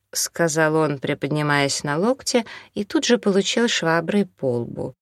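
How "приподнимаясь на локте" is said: plain reading voice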